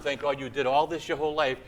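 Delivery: ordinary talk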